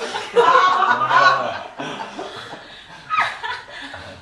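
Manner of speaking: long high airy laugh falling away; high breathless laugh. Real, not acted. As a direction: collapse into laughter. Laughing at the car stopping.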